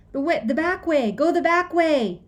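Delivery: loud, high urgent directions; yelling. A narrator's reading. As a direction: yell directions to someone running past. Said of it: "someone yells".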